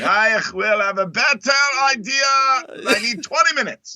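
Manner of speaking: high-pitched hammed-up German accent; mock German accent. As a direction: play a bossy German DP in a joke German accent. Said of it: "they all speak with Eastern German accents".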